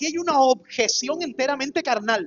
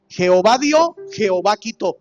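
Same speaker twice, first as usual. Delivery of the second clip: "Jehová dio" rising and loud, then falling; ringing declaration, rising then falling; proclaimed loudly; 3 semitones lower, 7 dB louder than usual. Acted. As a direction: declare it as Job's confession. Preacher quoting Job again.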